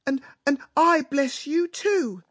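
high, slow, theatrical happy weeping; hammed-up tearful mama, loud and high; crying with happiness. A narrator's reading. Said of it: "crying with happiness".

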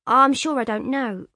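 high, loud offhand answer; careless. A narrator's reading. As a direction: toss it off carelessly.

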